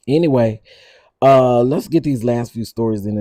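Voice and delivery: loud host switching stories; loud moving on. Real, not acted. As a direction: push the show forward, loud. Loudly moving on.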